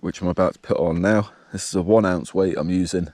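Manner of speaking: ordinary delivery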